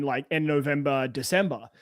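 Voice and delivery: usual speaking voice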